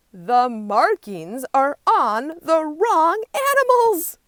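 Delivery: high, loud, slow shouting; angry outcry. A narrator's reading. Animals waking to find markings swapped, shouting high and loud. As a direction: cry out in outrage. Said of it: "cry the other animals".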